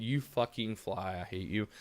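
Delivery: low irritated grumble at a buzzing fly; grumbling at a fly. Real, not acted. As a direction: curse the fly under your breath. Muttering at a fly mid-rant.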